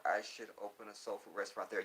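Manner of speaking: normal talking voice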